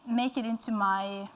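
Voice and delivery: usual speaking voice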